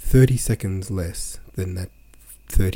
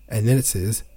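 usual speaking voice

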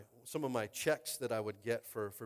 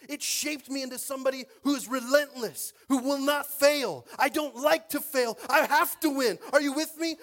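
preaching at full force; 10 semitones higher, 11 dB louder than usual. Acted. Preacher shouting his testimony to the congregation, at full force. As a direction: build into a roaring, relentless proclamation. Climax of a sermon.

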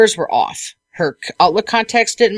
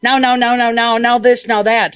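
mock impatient client; 3 semitones higher than usual. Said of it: "one of those people that's like".

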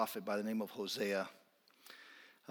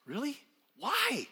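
much higher, disbelieving squeak; incredulous; 14 semitones higher, 4 dB louder, slower than usual. Acted. Preacher reacting to God's command with high incredulity.

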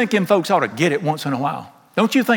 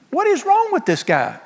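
much higher, baffled cry; incredulous outburst; 14 semitones higher than usual. Real, not acted. Preacher baffled by an angry prophet, incredulous high cry. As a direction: throw it up high in disbelief. Preacher marvelling at Jonah's anger.

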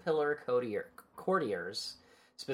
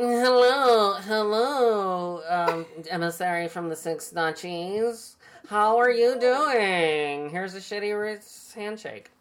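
high, loud, drawn-out nasal voice; badly imitated nasally accent, stretched and high; weird nasally accent; 7 semitones higher, 5 dB louder than usual. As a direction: do a terrible nasal narrator's character voice. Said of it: "gave him a weird nasally accent".

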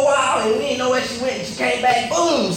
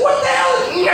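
very high imitated toddler yell; little screech; 3 dB louder, faster than usual. Comic mimics his niece's screech, yelling. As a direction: squeal it like a defiant little kid. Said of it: "This little screech and yell".

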